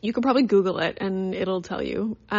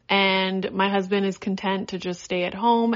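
ordinary talk, nothing marked

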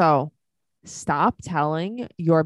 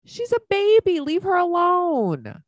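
much higher, slow pleading cry; 13 semitones higher, slower than usual. Real, not acted. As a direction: beg them to leave her be, slowly. Pleading for the child.

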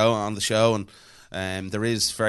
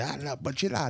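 higher, softer light mock Italian; 6 semitones higher, 6 dB quieter than usual. Imitating the Italian passenger, light mock Italian. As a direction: mumble fake Italian. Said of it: "He was like".

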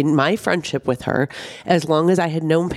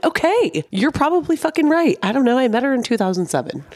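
much higher, sing-song mock concession; sarcastic agreement; 9 semitones higher than usual. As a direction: roll your eyes while conceding. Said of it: "And I'm like".

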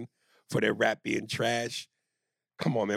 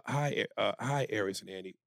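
ordinary delivery

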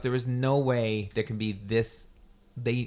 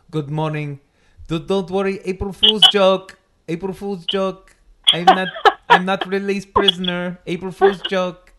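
higher, louder mock Brazilian yelling, slowed; imitating Brazilian accent; 7 semitones higher, 9 dB louder than usual. Retelling the jailbird runner in the park, mock Brazilian yell. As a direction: call it out to joggers in a hammed Brazilian accent. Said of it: "he's got an accent... Brazilian... he's just yelling".

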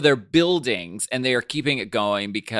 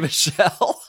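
usual speaking voice

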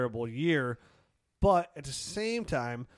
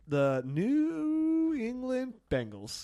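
drawn-out joke; 10 semitones higher, much slower than usual. Real, not acted. Deliberate joke mix-up.

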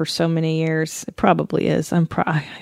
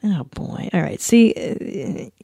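ordinary delivery

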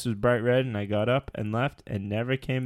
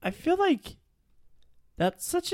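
squeaky voice. Pretend. Squeaky high put-on voice.

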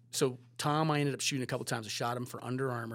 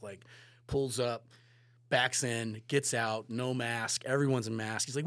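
usual speaking voice

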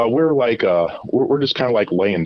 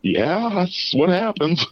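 higher, slow mock reply; his own answer; 12 semitones higher, slower than usual. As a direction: answer slowly and knowingly. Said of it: "I'm like".

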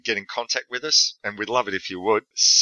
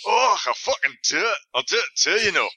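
put-on high Scottish accent; 11 semitones higher than usual. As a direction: say it in a broad Scottish brogue. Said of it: "you talking in that voice".

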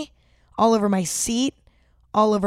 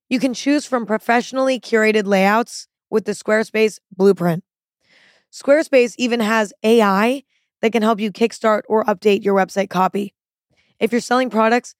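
ad read; 4 dB louder than usual. Acted. Host reads sponsor ad.

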